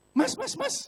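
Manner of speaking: high, loud re-enacted yell for help; acted panic, shrieked high; panicked shout. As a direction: urgently call out to the attendant, voice shooting up. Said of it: "Saya langsung teriak".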